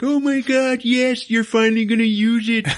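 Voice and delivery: put-on squealing with delight like a kid; giddy excitement. Tinkerer thrilled the villain will finally use his device, gushing loudly.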